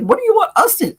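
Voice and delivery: high puzzled dog voice; a baffled pet; voicing confused dogs. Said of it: "looking at us like".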